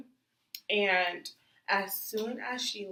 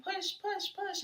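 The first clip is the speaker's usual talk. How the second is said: higher, coaxing push push push; imitating nurses; 12 semitones higher than usual. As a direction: coach her like the nurse. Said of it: "They're like".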